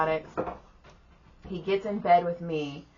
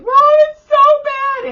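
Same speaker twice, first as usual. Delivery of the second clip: very high, loud mock wail; exaggerated squealing cry; crying child's wail; 17 dB louder than usual. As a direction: imitate a kid bawling for mom. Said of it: "And he's crying".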